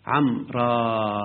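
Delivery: عمرا drawn out very slowly to the final alif; slow model of عمرا; stretched final alif. Shown as a demonstration. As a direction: linger on عمرا as the correct pause. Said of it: "لو وقفت وقف بالألف".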